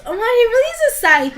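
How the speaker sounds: high, quick, gushing mock surprise; Blair, mock amazed. Witch Blair seeing he is a scythe, mock amazed.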